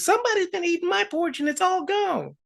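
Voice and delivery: very high little bear cub voice; Baby Bear. Acted. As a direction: squeak out a small cub's complaint. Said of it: "Baby Bear says".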